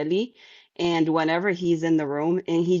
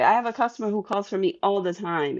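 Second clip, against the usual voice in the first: ordinary talk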